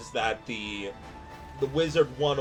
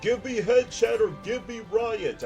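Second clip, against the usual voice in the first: excited; 10 semitones higher, 7 dB louder than usual. Real, not acted. Excited joking demand to chat.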